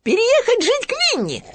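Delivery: very high, loud squeaky cheer; joyful Piglet exclaiming. Acted. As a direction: burst out happily like Piglet. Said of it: "радостно воскликнул Хрюня". (радостно, пискляво восклицает Хрюня)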